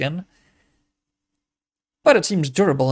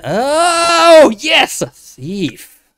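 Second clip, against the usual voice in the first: loud, high, drawn-out glee; delighted outburst; 10 semitones higher, 10 dB louder, much slower than usual. Real, not acted. Player finding a thief at last, loud gleeful outburst.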